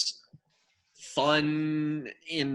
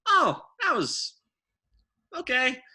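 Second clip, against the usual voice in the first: high, surprised "oh, that was okay"; like mildly caught off guard by a sound; mock surprised reaction; 9 semitones higher, 4 dB louder than usual. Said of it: "Just kinda like".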